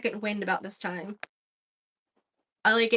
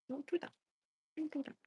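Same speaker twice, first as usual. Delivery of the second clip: quiet scold under breath; muttering; 5 semitones higher, 13 dB quieter, faster than usual. Real, not acted. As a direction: mutter it to yourself. Soft scolding to material.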